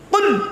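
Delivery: "কুল" high, with a throaty q, falling; correct Arabic qul. Shown as a demonstration. Preacher showing the congregation the difference, correct Arabic qul. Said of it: "উচ্চারণের পার্থক্য".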